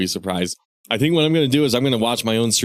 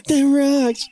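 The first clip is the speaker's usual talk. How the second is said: high character voice; 11 semitones higher, slower than usual. Acted.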